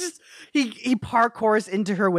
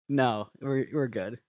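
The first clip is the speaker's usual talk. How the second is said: low quiet cover; 7 semitones lower, 7 dB quieter, slower than usual. Retold or imitated.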